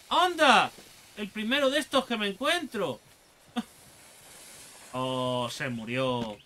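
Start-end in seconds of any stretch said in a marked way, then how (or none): 0.1-3.0 loud high "anda!"; delighted surprise. Real, not acted. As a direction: cry out in glad surprise. Finds something new.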